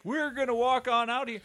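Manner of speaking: lightly acted, high-pitched robber; mock robber announcement. Robber leaving with a hostage, high mock announcement.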